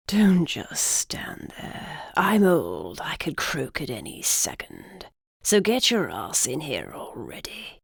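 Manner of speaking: put-on slow whispery old man. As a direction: rasp it out like a cranky old man. Old griffin speaks, whispered rasp.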